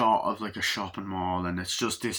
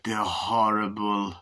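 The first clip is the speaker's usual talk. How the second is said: tired groan; slower than usual. Retold or imitated. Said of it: "he's like".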